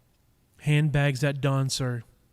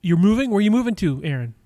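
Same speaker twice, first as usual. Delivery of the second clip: louder, higher, caught off guard; real surprise; 6 semitones higher, 8 dB louder than usual. Real, not acted. Streamer to viewer Aaron who says he's moving: loud surprise. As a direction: jump on the news, startled. Reacts to chat news.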